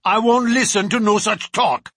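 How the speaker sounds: rattled thug refusing to hear it; loud denial. A narrator's reading. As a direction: shout him down in alarm. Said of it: "said he".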